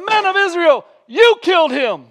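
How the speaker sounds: high, loud shouted accusation; bold proclamation. Retold or imitated. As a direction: declare it to the crowd. Peter preaching boldly.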